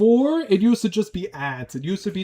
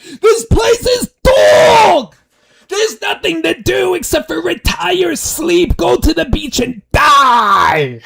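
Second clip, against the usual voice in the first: screamed high rant about Florida; howling, crying-out mock rant; mock tirade; 10 semitones higher, 10 dB louder, slower than usual. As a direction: shout it like a furious local fed up with the place. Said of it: "and it's like".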